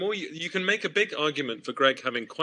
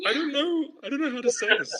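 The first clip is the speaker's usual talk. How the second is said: mock panic, pitched much higher; panicked actor; 12 semitones higher than usual. Actor retells freezing on an improv, mock panicked. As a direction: squeak it out like you've lost the line. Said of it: "And I would go".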